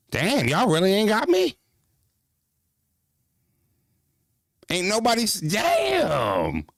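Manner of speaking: hurt shout at the silent chat; mock outrage. Real, not acted. Chat ignores his timestamp request.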